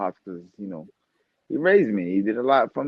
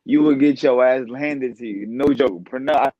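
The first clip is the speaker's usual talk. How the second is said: usual speaking voice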